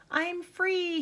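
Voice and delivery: high-pitched gleeful storybook cry; excited yelp. A narrator's reading. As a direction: yelp it out, thrilled to be free. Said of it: "he yelped".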